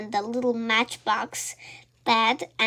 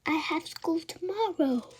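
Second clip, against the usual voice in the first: whispering; 6 semitones higher, 7 dB quieter than usual. A narrator's reading. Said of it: "I whispered".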